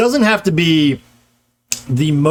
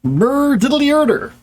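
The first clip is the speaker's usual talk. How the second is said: chirpy cartoon voice, much higher; Ned Flanders; 9 semitones higher than usual. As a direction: bounce through it like the cheery cartoon neighbour. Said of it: "this is what Ned Flanders does".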